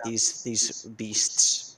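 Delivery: drawn-out low words; slow, ominous. Acted. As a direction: stretch it out like a sailor's tale. Player voices character slowly.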